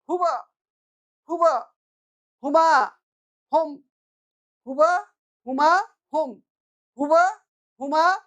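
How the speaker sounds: a model chant for the class; Arabic drill recitation. Shown as a demonstration. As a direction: call out each pronoun loudly and crisply. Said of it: "teacher drills pronouns again".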